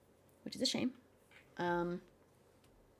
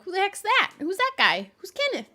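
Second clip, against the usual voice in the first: confused newcomer; 9 semitones higher, 6 dB louder than usual. Retold or imitated. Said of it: "you'd be like".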